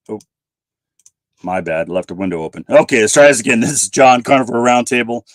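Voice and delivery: ordinary talk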